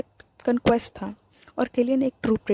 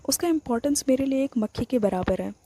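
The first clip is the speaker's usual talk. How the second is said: plain reading voice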